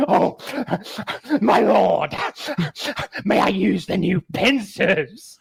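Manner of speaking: high, slow hammed-up ogre plea. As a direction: beg like a groveling ogre. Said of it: "his ogre voice".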